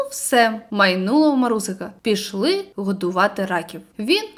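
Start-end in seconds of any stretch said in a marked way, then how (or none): none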